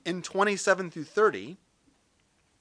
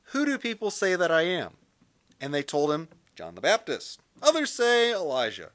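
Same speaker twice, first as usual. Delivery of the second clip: louder, measured Bible reading; scripture reading; 4 dB louder than usual. Acted. Pastor reads Mark to his listeners, measured and clear. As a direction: read the passage out to the congregation.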